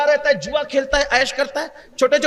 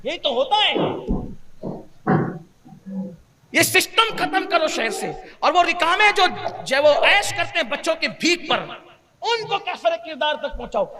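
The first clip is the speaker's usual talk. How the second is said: fervent preaching; 3 semitones higher than usual. Acted. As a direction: rouse the crowd against the beggar trade. Preacher pleads against child begging. (پرجوش خطیبانہ انداز)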